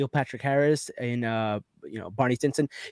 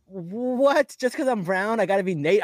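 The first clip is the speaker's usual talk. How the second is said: high-pitched retold disbelief; indignant retort; 7 semitones higher than usual. Cosplayer answers fans who mistook him for Nate, mock indignant. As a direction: replay an affronted comeback, pitched up.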